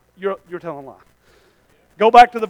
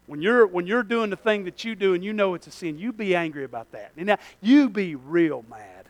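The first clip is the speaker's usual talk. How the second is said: ordinary delivery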